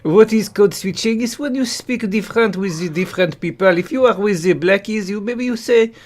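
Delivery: animated mock French lecturer; hammed-up French-accented English. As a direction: explain it in a thick put-on French accent.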